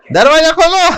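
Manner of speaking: sharp, high-pitched mock yell; imitated thunderous call. Munni behen orders the lift door opened, imitated loud yell. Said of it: "तब एक जोरदार आवाज हुई".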